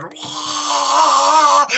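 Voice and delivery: long high-pitched victory scream; triumphant scream. Retold or imitated. Fighter screaming after his choke win, imitated high scream. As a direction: let out a long, high "wah". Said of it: "he started screaming".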